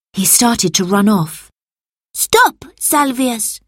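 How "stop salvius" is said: commanding. A narrator's reading. Marcus to the fleeing Salvius, commanding. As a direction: call out to stop a runaway. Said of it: "commanded Marcus".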